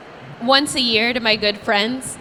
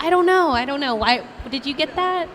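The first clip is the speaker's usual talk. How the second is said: usual speaking voice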